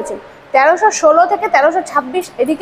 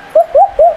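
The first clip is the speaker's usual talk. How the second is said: high playful hooting; sing-song hoo hoo; 13 semitones higher, 3 dB louder than usual. Real, not acted. Playful noise at the lift.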